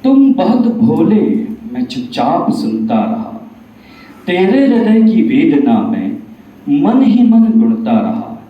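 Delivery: declamatory verse with strong openings falling away at line ends; Bhagwan in recited verse. Acted. Bhagwan replying to Kaliyug after listening silently, declamatory recitation. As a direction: recite as a deity answering a challenger in measured rhyme. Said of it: "यहाँ भगवान कलयुग से बताते हैं".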